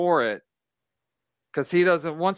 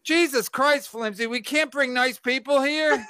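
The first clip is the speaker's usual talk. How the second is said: exasperated; 8 semitones higher than usual. Real, not acted. Exasperated at crude comment.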